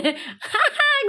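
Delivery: high squealing laugh. Real, not acted. Laughing at vendor mix-up. (tawa melengking)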